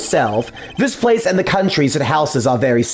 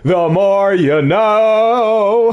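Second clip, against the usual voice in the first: PSA announcer; much slower than usual. Acted. PSA catchphrase voice.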